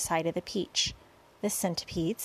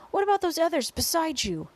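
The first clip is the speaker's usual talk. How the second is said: high, raised story-reader's shout; shouted question; 9 semitones higher, 4 dB louder than usual. A narrator's reading. Police chief to boy up on the peach, shouting. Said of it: "shouted the chief of police".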